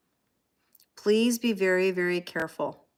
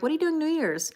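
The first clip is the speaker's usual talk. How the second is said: higher-pitched imitation of people asking; mimicked eager question; 8 semitones higher than usual. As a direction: imitate everyone's chirpy holiday small talk. Mimics everyone's nosy holiday question.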